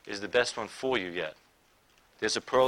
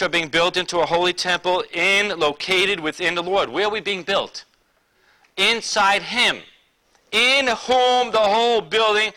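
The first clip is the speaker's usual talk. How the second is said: loud, higher-pitched proclamation; full-force preaching; 7 semitones higher, 9 dB louder than usual. Acted. Preacher proclaims scripture to the church, emphatic preaching. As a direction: drive the verse home, raised voice.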